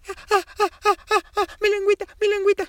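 very high, loud, fast panicked chick cries; pollito enchilado llorando. A narrator's reading. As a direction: gasp out the burning tongue in panic. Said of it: "los ojitos le empezaban a llorar". (pollito llorando con la lengua enchilada, muy agudo)

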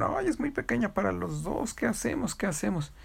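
fretful hens. Acted. Chickens fretting the cage is too small, in high worried voices. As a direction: fuss over it like anxious hens.

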